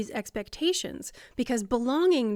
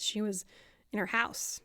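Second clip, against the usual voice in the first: ordinary talk, nothing marked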